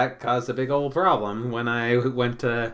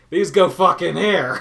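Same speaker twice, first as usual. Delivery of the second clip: loud, higher mock outburst; blunt dismissive outburst; 6 semitones higher, 11 dB louder than usual. Imitating him stashing bread in the microwave, loud and dismissive. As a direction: toss it off like it's obvious and you're annoyed. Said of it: "so he's just like".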